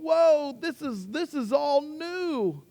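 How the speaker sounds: high, awed whoa; wide-eyed wonder. Acted. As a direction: marvel at a brand new world. Caterpillar voiced coming out amazed.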